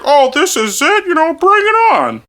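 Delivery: high-pitched mock daredevil; mock bravado. Mimicking the rider's boast before the ride, mock bravado. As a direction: crow it in a high imitating voice. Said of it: "He's like".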